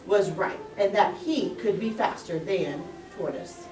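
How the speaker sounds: ordinary delivery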